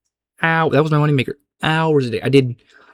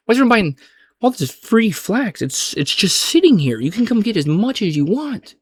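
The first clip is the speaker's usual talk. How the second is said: high-pitched, loud, baffled protest; utterly baffled, hammed-up; acted incredulity; 9 semitones higher, 3 dB louder than usual. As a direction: exclaim it in mock disbelief, pitch swooping high. Acting out bafflement at flax buyers.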